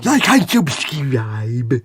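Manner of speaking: slow, slushy lisped whisper; lateral lisp. Shown as a demonstration. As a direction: lisp it wetly through the sides. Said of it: "Gotta have that lateral lisp".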